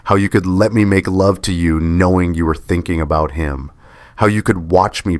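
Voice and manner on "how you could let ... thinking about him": ordinary delivery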